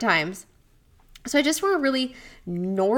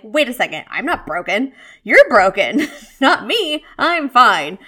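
mock-offended, getting louder up to a near shout on broken; indignant protest; 2 semitones higher, 9 dB louder than usual. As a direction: pretend to be insulted and push it back loudly. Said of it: "You might have been like".

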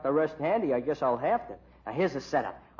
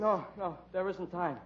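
weak dying voice; 6 dB quieter than usual. Acted. Dying man on floor.

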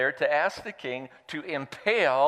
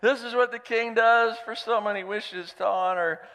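higher, louder proclamation; reluctant shout; 9 semitones higher, 4 dB louder than usual. Acted. Haman forced to proclaim Mordecai's honor, loud and high. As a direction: call it out across the square. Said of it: "led him through the city square shouting".

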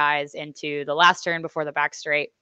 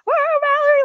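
high, loud woo cheer; cheering scream; 7 dB louder than usual. Retold or imitated. Family cheering rider from stands, screaming. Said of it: "screaming at the top of their lungs".

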